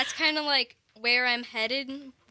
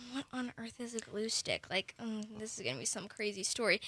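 imagined puzzled listeners; 7 semitones lower, 13 dB quieter, faster than usual. Retold or imitated. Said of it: "all of you are like".

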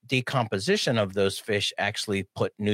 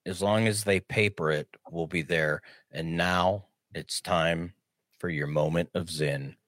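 announcer voice; 7 semitones lower than usual. Acted. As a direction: deliver it like a radio sign-off. Announcer outro, lower and slow.

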